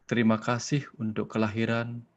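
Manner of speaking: hushed, unhurried prayer leader; guided prayer. Acted. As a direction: settle the listeners into silence, then pray. Leads a prayer and meditation.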